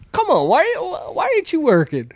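high, pushy boss voice nagging; mocking the boss. Retelling how the boss nags him back to work, in a high mocking voice. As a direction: needle him like an impatient boss. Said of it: "he'll tell you the story and be like".

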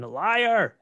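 high, loud accusing shout; yelling. Retold or imitated. Wife yelling at her husband, a loud accusation. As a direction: hurl it at him. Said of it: "she starts yelling".

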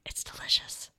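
whispered "it's delicious"; soft conspiratorial whisper. Real, not acted. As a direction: hush it like a secret treat. Whispered wine tip.

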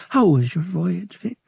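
lightly voiced curious girl; female voice.

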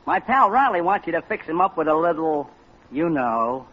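ordinary delivery

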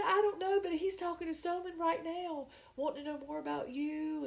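high, excited gossiping friend; a lightly voiced excited friend; excited Melba. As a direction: spill the news like a giddy matchmaker.

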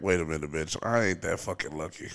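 deadpan dismissive retort. Retold or imitated. Retelling his reply to DM.

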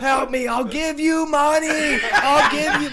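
high loud begging shout; mock pleading yell. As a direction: beg loudly like a drunk stuck in the basement. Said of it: "I'm like".

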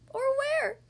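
very high, loud tearful whine; sniffling. Acted. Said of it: "he sniffled".

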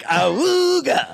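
slow, high honking ahooga; ahooga horn. Pretend. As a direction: honk it like an old car horn. Prank reveal sound effect.

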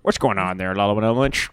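ham radio voice. Acted. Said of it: "you dropped your ham radio voice".

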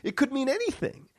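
high-pitched, incredulous protest; hammed-up indignant squeal; retold exasperated retort. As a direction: argue back with the voice climbing. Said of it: "And I said".

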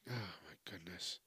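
low, whispered imagined reaction; hushed dismay. Retold or imitated. Tom Hanks reacting to his son's girlfriend, hushed dismay. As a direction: say it under your breath, low and very soft.

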